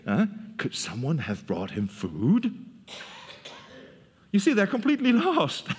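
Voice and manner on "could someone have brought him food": puzzled disciples. Acted. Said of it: "his disciples said to each other".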